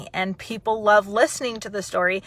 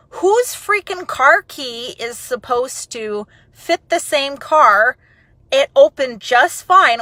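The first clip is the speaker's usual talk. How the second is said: indignant rant; 5 semitones higher, 8 dB louder, slower than usual. Retold or imitated. Indignant rant about the key.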